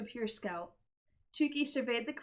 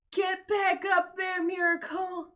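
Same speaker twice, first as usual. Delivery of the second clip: high, loud, slow screamed order; father screaming at his daughter; 8 semitones higher, 5 dB louder, slower than usual. Acted. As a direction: scream it at her. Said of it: "Mister De La Creme screamed".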